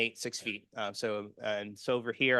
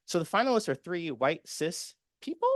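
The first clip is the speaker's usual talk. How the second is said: higher, disbelieving question; incredulous; 7 semitones higher than usual. Retold or imitated. Activists reacting to the finalist list, higher and incredulous. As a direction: ask it like you can't believe the list. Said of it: "And we're like".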